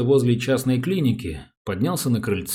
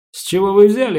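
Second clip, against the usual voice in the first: loud, high mock astonishment; feigned surprise; 9 semitones higher, 9 dB louder than usual. A narrator's reading. Policeman feigns innocence to suspicious wife, loudly surprised. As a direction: act taken aback, voice jumping up. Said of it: "округлил глаза Дрёмин".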